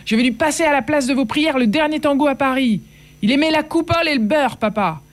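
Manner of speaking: high, furious defiance; shouting back. Acted. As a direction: fling it back at them, refusing to yield. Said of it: "je criai à mon tour".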